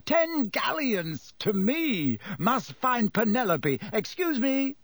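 high, pleased exclamations; delighted. A narrator's reading. Percy after winning his bet, delighted. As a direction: crow happily in a lifted pitch. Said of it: "looking delighted".

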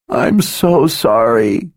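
high, slow tearful apology; crying. A narrator's reading. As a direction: sob the words out. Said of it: "she cried".

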